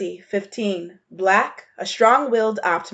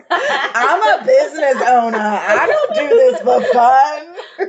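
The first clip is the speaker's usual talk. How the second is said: laughing mock repeat, louder and drawn out slow; mocking while laughing; 6 semitones higher, 6 dB louder, slower than usual. Teasing Audi by echoing her business owner line, laughing. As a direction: parrot her boast through giggles.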